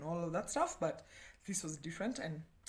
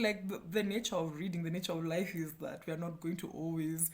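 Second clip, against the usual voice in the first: normal talking voice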